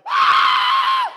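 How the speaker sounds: high, loud delighted shriek; excited squeal of children seeing flying chairs; kids' shriek. Retold or imitated. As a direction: shriek like a thrilled kid watching a dust devil. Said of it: "My kids were standing in the yard going".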